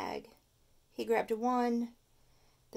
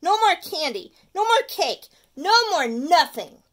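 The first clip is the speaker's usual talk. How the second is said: higher, much louder shout; 7 semitones higher, 11 dB louder than usual. Acted. Stuart shouting at his sweet tooth. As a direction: lay down the law. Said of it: "shouted Stuart".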